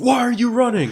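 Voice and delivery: very high, loud, laughing shout; mock yell. Joking about chasing girlfriend, laughing mock yell. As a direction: yell after a runner, half laughing. Replaying chase, yelling after girlfriend.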